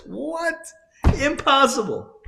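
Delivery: can't believe what he heard; stunned disbelief. Retold or imitated. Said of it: "He says".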